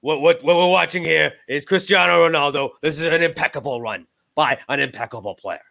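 lightly put-on commentator accent; mock TV pundit gushing over a player; a hint of a shaky Italian-ish pundit voice; Stephen A Smith impression. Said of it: "I'm not great with the accent".

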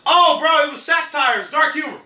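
high, loud mock defensive bro voice; mocking friends. Friends brushing off blackface, mock whiny bro. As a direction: ham up the excuse. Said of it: "you kept saying".

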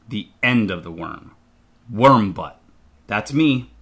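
ordinary delivery

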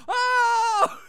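high, gleeful mock squeal. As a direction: imitate an overjoyed cry, far above normal pitch. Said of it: "He's so excited".

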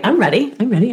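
normal talking voice

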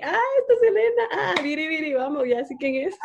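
ah, so THAT is Selena, half laughing; sudden realization. Retold or imitated.

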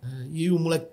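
ordinary talk

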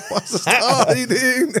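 high, drawn-out Ballymun greeting, laughing; put-on Ballymun voice. Shown as a demonstration. Greeting his co-host on request, high put-on Ballymun voice. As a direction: stretch it out high in a comic Ballymun voice. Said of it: "would you do your voice that you do".

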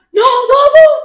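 high, very loud bark; sudden mimicked scream; imitated police yell. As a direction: shout it like an officer giving orders. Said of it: "gritando como que".

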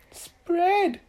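high, drawn-out order; magical command. A narrator's reading. Little Miss Magic orders a knife, high loud command.

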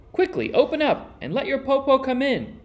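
high wheedling disguised wolf; cunning wolf voice. A narrator's reading. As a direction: coax the children to open up. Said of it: "the cunning wolf said".

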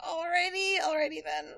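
high, laughing awkward alrighty; laughing awkwardly. Real, not acted. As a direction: laugh through an uneasy alrighty then. Awkward reaction to crude comment.

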